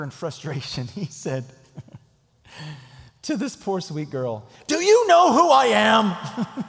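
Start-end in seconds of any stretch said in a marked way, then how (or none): 4.7-6.6 a hammed-up outraged yell, high and loud; mock indignant shout. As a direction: bellow it like a self-important man. Angry man's punchline on phone.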